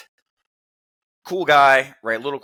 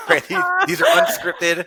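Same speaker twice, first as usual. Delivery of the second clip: laughing out the words, much higher. Real, not acted. Host laughing while assuring the audience, laughing high. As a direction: insist through a burst of laughter.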